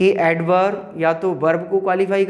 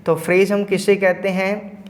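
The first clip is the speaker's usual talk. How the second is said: ordinary talk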